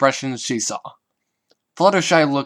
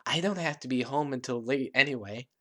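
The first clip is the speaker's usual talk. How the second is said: much softer, gentle; barely above a murmur; softly to her friends after the show; quiet; 8 dB quieter than usual. A narrator's reading. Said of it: "something quiet and warm".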